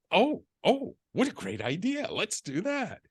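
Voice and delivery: high-pitched, pleasantly surprised reaction; retold delighted agreement. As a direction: act out jumping at a great idea. Retold delight at wife's idea.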